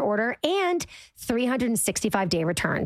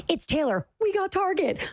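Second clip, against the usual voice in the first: higher, hammed-up promo delivery; mock promo line; 7 semitones higher than usual. Host acting out her awkward camera take, mock promo voice. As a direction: play a cheesy spokesperson, pitch up. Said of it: "literally going on camera and saying".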